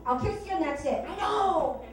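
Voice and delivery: ordinary talk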